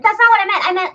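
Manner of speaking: high, loud, hurried protest; flustered protest. Retold or imitated. To a misunderstanding listener, blurting a loud flustered correction. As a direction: rush to correct yourself, flustered. Retold defensive over-explaining.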